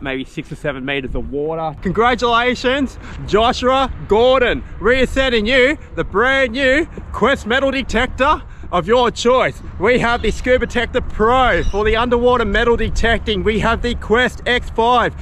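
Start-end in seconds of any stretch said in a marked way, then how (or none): 1.8-9.6 upbeat sponsor read; bright promo announcer voice. Acted. 9.8-15.1 upbeat sponsor read; bright promo announcer voice. Acted.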